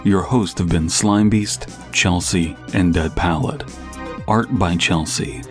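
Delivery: ordinary talk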